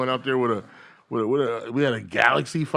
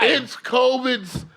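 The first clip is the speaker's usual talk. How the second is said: mock slow insistence; 11 semitones higher, much slower than usual. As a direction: stretch it out like it's obvious.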